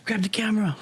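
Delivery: put-on excited teen grabbing the camera; eager self-urging. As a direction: rush yourself to get filming.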